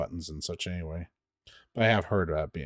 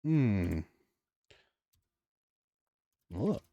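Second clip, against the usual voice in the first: ordinary talk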